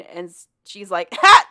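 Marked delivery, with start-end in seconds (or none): 1.1-1.5 sudden loud, high "ha" of mock shock; startled outburst. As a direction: burst out as if spooked, loud and high. Startled reaction to ghost's claim.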